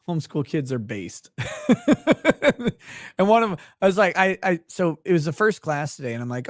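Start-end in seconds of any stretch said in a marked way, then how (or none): 1.4-3.1 bursts into a high burst of laughter. Real, not acted. Laughs at the kid's answer.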